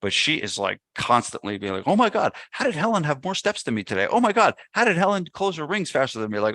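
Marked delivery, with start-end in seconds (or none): 1.9-6.4 imitating wife. Wife voiced fretting over steps.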